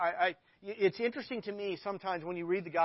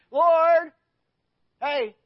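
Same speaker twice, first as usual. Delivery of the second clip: eager call; 9 semitones higher, 14 dB louder than usual. Acted. Peter shouting up to Jesus, a high loud excited call. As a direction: call out Lord like an excited disciple.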